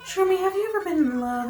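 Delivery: Pelly. Acted. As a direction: pitch it up for a lovestruck character. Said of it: "I need a different voice for her".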